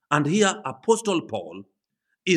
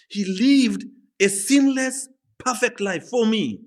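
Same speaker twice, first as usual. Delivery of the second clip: fervent testimony; 8 semitones higher than usual. Acted. Preacher voices Paul's testimony.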